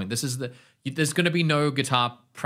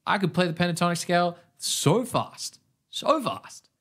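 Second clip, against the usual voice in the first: excited; 6 semitones higher than usual. Real, not acted. Boasting of past speed.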